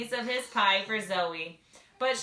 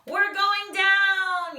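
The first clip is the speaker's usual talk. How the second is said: high, loud, slow panicked yell; yelled alarm; 12 semitones higher, 10 dB louder, much slower than usual. A narrator's reading. Sam yells to Zoe as the ship falls, a slow panicked yell. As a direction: cry out as the ship goes down. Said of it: "yelled Sam".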